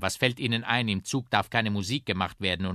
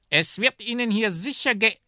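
high, loud trumpet voice; chatty trumpet; 9 dB louder than usual. Acted. Trumpet reassures its companion until interrupted, high loud voice. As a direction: pipe up loudly, then break off.

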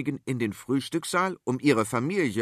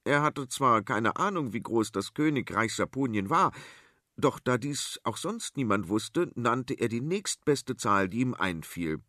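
ordinary delivery

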